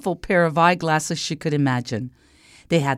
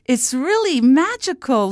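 put-on high child's wonder, growing higher; high-pitched building delight; exclaiming princess; 6 semitones higher than usual. A narrator's reading. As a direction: marvel like a thrilled little girl. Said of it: "she exclaimed".

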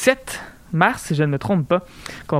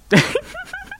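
high-pitched laugh. Real, not acted. Laughs at refusing to share.

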